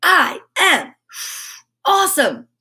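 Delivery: put-on bright, high "I am awesome" for the kids to copy; upbeat affirmation. Shown as a demonstration. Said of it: "Here we go".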